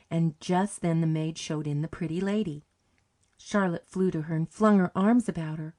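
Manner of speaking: ordinary delivery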